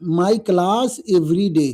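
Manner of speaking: very slow, word by word for notes; slow dictating teacher; slow dictation. Shown as a demonstration. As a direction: spell it out, then say it slowly. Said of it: "A double T E N D".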